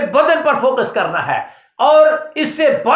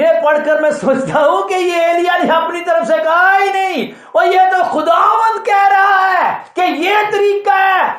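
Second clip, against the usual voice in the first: raised, emphatic preaching voice; preaching at full force; 5 semitones higher than usual. Acted. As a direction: drive the point home to the congregation. (جوشیلا وعظ، بلند آواز)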